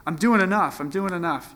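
playing his own prideful thought; smug self-talk. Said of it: "pride in me that's like".

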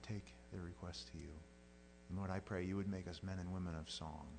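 soft earnest pastor's prayer; closing prayer. Acted. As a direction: pray it gently over the congregation.

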